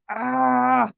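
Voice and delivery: high groan of frustration; a short sharp disgusted groan; frustrated ugh. Shown as a demonstration. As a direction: let out a fed-up ugh. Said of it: "even if there's no word to it".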